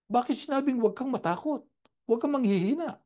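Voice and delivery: pitched high, urgent rhetorical challenge; impassioned question. Acted. Preacher challenging the congregation, impassioned high question. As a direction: fling the question out to the pews.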